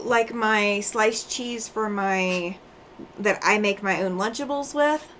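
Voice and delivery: ordinary talk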